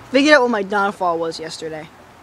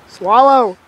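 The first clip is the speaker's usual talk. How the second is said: loud urging; 3 semitones higher, 6 dB louder than usual. Real, not acted. Urging friend to swallow.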